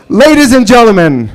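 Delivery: big stage-host announcement; announcer voice. Acted. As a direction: hype up the crowd like a ring announcer. Host announcing next comic again.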